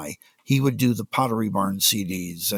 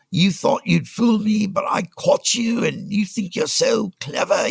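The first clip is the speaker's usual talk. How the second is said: high, slow, hammed-up South African rant; mock Afrikaner yelling; 9 semitones higher than usual. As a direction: imitate the Afrikaner boss screaming down the phone. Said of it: "yelled at in this exact dialect".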